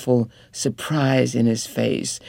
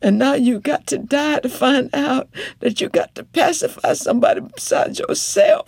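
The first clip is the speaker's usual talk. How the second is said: much higher, driving reproach; impassioned wife; 13 semitones higher, 4 dB louder than usual. Acted. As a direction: throw it back at him without pause.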